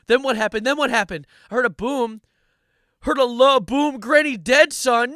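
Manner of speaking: loud, high, urgent retelling; hammed-up drama. Comic acts out granny's death lyric, hammed-up drama.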